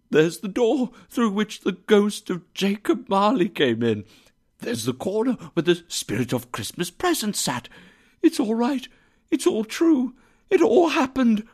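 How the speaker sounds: high, giddy exclamations climbing in pitch; excited cry. A narrator's reading. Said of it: "cried Scrooge, going around the room".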